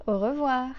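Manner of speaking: au revoir with a French R; teacher's au revoir. Shown as a demonstration. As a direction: model au revoir clearly. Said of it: "means goodbye".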